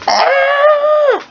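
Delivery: very high, loud comic scream; sustained piercing mock scream of pain; old man's yelp. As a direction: shriek as if smacked in the face. Old man hit in the face.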